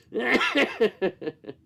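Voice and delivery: breathy giggle fit; laughing. Real, not acted. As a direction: dissolve into giggles. Laughs again.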